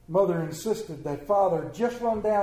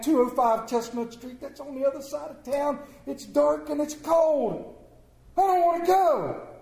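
whiny complaint; 9 semitones higher than usual. Acted. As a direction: whine it like a sulking dad. Father whining about cold trip.

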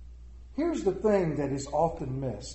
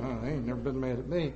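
low, quick mumbled denial; soft, hurried mock innocence; husbands in denial; 7 semitones lower, 7 dB quieter, faster than usual. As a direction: imitate men quietly protesting innocence. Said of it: "Look at all these men going".

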